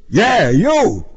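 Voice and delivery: loud, high cop's jab; forceful, confrontational reply; accusing retort. A narrator's reading. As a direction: pin the blame on him loudly. Cop accuses driver.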